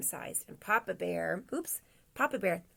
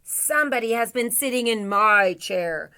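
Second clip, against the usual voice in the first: loud, booming storybook bear; Papa Bear's great big voice; 2 semitones higher, 8 dB louder than usual. A narrator's reading. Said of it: "in a great big voice".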